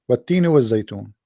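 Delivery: rapid Arabic recitation of والتين والزيتون; Quranic Arabic recitation. Shown as a demonstration. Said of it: "Allah swear by olive and fig".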